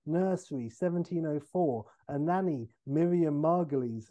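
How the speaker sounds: usual speaking voice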